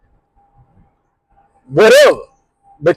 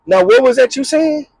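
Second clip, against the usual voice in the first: high menacing dare; confrontational challenge; 8 semitones higher than usual. Retold or imitated. Woman squaring up at the bar, high challenge. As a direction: get right in her face. Woman gets in the other's face.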